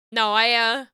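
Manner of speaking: usual speaking voice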